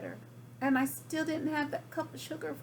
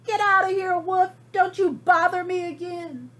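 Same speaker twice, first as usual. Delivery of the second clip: higher, louder, rude little pig; rude shout; 6 semitones higher, 7 dB louder than usual. Acted. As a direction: snap him away, rude and loud. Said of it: "what that rude little porker answered".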